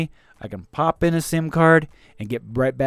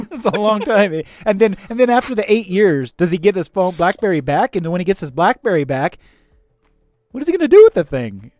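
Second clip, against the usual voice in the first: ordinary delivery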